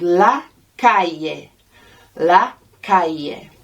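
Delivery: "La calle" with "calle" said "ka-ye", double L as a Y. Shown as a demonstration. Teacher modelling "La calle" for learners, LL as Y. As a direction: say "calle" as "KAH-yeh", LL like Y. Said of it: "Look at that double L. It gives us a Y sound".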